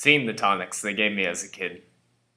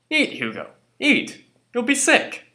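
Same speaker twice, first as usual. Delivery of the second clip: urging, leaping high and loud; nagging father; 13 semitones higher, 5 dB louder than usual. Acted. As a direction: press the child to eat, rising to a high cry. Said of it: "Every evening he'd say".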